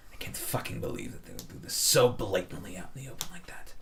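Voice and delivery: hushed conspiratorial whisper. Acted. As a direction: whisper it so no one overhears. Dwarf gossiping at bar.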